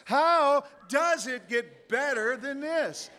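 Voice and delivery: "How" drawn out high; stress on How. Shown as a demonstration. Showing how emphasis changes the phrase, long high "How". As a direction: stretch "How" high, then go on slowly. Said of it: "put the emphasis on a different syllable".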